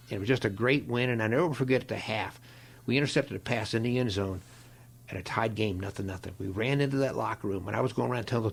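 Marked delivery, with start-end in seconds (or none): none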